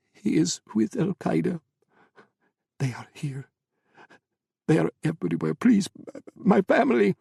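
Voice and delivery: high, shaky-sounding desperate plea; frightened pleading. Acted. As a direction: plead for his family. Owner begs in fear.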